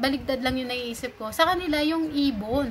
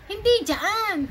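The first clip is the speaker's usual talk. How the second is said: imitating Bisaya friend; 9 semitones higher, much slower than usual. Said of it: "iba yung tono nung boses niya".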